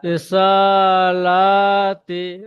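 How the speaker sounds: drawn out slowly and rising; slow demo reading. Shown as a demonstration. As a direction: stretch every syllable slowly. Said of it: "teacher demonstrates reading".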